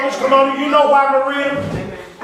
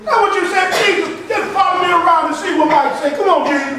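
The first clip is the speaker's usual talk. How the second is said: full-force preaching; 6 semitones higher than usual. Acted. Preacher chanting at the congregation, full force. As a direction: belt it to the congregation.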